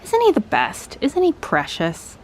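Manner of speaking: ordinary delivery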